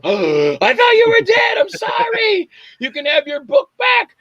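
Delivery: high, shouted mock terror; panicked apology. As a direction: plead in a hammed-up frightened squeal. Acts terrified apology to dead woman.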